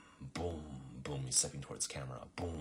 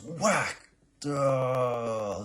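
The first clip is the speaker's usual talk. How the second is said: cartoon whack sound; 8 semitones higher, 9 dB louder than usual. She hits him.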